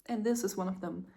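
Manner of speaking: ordinary talk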